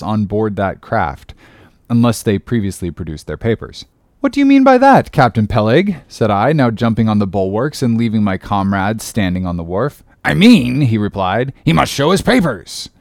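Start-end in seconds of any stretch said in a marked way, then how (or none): 4.2-6.0 high, loud protest rising from the start; affronted question; indignant challenge. A narrator's reading. Ishmael challenges Peleg on cannibals. 10.2-10.8 louder, higher gruff hail; gruff captain. A narrator's reading. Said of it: "in his gruff voice". 11.6-12.9 higher-pitched gruff sea captain; gruff, raised-pitch order. A narrator's reading. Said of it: "in his gruff voice".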